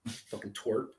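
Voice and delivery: muttering. Real, not acted. Muttering insult about Jules.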